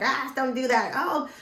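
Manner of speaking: put-on snapping at the kids like an exasperated mother; mom yelling. Mother yelling at teenage boys to pick up stuff, high mock yell. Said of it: "I yell".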